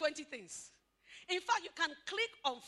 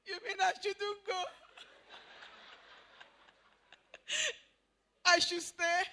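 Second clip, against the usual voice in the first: coy delighted wife; 5 semitones higher, 3 dB louder than usual. Pretend. Wife melts at husband's plea.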